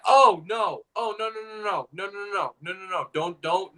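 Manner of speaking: retold dismay; mock horrified pleading. Acted horror at Nana's proposition.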